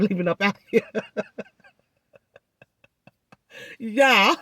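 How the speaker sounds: breaking up into real laughter; laughing. Real, not acted. Telling viewers how she acted a fool, laughing. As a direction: laugh helplessly at yourself, pitch climbing.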